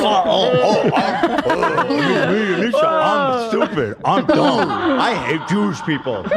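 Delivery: silly voice. Retold or imitated. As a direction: play a dopey cartoon girl. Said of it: "You just did a silly voice".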